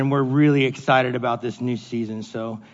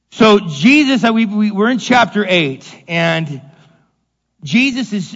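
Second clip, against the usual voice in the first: loud, driving preacher voice; fiery preaching; 3 semitones higher, 4 dB louder than usual. Acted. Pastor preaching forcefully to his congregation, loud and fervent. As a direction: press the point to the congregation.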